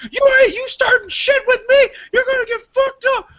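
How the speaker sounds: very high, loud mock screech; imitated yelling. Retelling the lady behind him starting a fight, screechy mock yelling. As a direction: shriek it like an enraged heckler. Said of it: "the lady behind me starts yelling".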